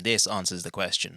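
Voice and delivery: normal talking voice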